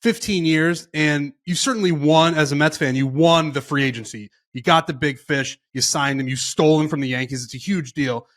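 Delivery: normal talking voice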